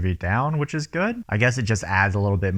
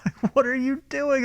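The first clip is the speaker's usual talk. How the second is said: much higher laughing exasperation; high, laughing what are you doing; exasperated disbelief. Real, not acted. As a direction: throw up your hands in a high voice. Exasperated at the mom.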